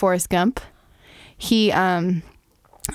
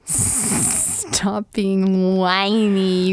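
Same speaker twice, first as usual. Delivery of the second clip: drawn out, very slow; much slower than usual. Acted. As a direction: stretch each word out slowly. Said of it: "echoes slowly, mock PSA".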